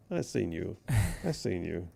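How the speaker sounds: low and soft, starstruck murmur; hushed awe. Retold or imitated. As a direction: say it under your breath, a little awed. Retelling meeting Doctor Phil, awed.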